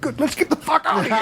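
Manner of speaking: very high, rushed squeal; comic urgent escape line; mock relieved panic. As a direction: blurt it fast and high, desperate to bolt. Retold relieved urge to flee.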